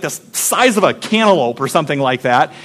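ordinary talk

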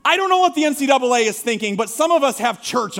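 hammed-up indignation at the schedule; mock outrage. Preacher to congregation, a hammed-up outraged rant.

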